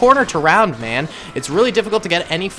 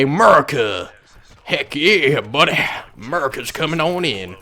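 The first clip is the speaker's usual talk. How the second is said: bad Southern American accent. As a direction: put on a clumsy Southern US accent. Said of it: "Southern American accent ever".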